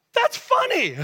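very high-pitched, incredulous outburst; amused exclamation. Real, not acted. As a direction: exclaim it way up high, defending the joke. Defends his joke to congregation.

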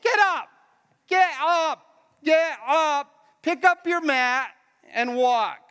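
high, emphatic order; urgent command. Acted. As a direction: drive him onto his feet. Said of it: "He's like".

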